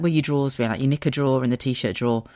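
ordinary delivery

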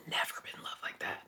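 almost silent, breath only; fading whisper. Real, not acted. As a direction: let the words die away to nothing. Repeats it even softer.

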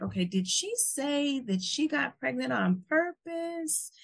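high, slow, incredulous audience voice; imitating shocked listener. Host voicing the shocked audience, high and slow. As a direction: drag out the disbelief on "sixteen". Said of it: "audience ... is thinking".

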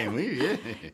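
said through a laugh. Real, not acted. Host reacting to the caller's romance, laughing. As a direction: answer while still laughing.

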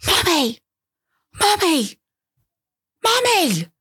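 put-on toddler's demanding shout in the supermarket; mock toddler yell. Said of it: "he started shouting so loudly".

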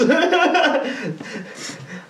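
high long laugh; real laugh. Real, not acted. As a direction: laugh through it.

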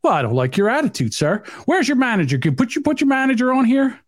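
indignant customer. Pretend. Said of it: "I'll pull up".

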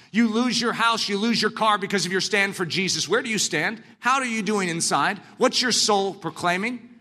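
ordinary talk

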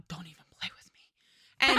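threatening whisper; whispered warning to the thermostat. Retold or imitated. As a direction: hiss it low at the thermostat. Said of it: "I'm like".

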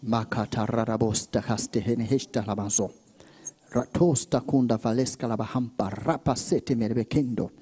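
very low, soft, even murmur; hushed tongues prayer. Real, not acted. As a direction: pray in tongues under the voice.

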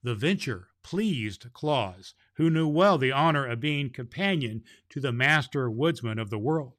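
ordinary delivery

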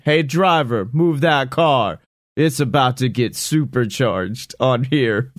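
ordinary talk, nothing marked

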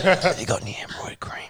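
whispered, sheepish request; embarrassed whisper. Retold or imitated. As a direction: hush it so the shop can't hear. Asking for cream at the chemist.

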